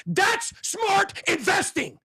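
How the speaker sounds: high, slow emphatic rant; high-pitched ranting yell; ranting shout. Real, not acted. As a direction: hammer it home at the critics. Ranting at critics.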